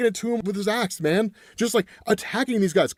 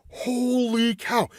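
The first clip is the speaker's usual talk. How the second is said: drawn-out, higher awed holy cow; amazed exclamation; 6 semitones higher, much slower than usual. Real, not acted. Recapping a brutal comic scene, slow high amazement. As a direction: let the shock stretch the words out. Awestruck reaction to the torching.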